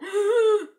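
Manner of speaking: loud high sharp gasp; big theatrical gasp; dramatic gasp. Acted. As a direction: gasp in mock shock. Mock gasp in recap.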